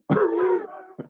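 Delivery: high burst of laughter; laughing. Real, not acted. Laughs at viewer's message.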